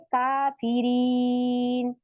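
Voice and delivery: काफिरीन slowly, ending on a silent न; slow recitation, काफिरीन stilled at the end; correct stop. Shown as a demonstration. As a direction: recite काफिरीन with the last vowel dropped. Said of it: "इसको हम पढ़ेंगे".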